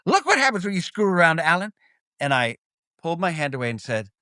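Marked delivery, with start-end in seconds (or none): 0.0-1.7 high, shocked teacher voice; teacher scolding. Retold or imitated. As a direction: play the startled teacher scolding a student. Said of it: "And she goes".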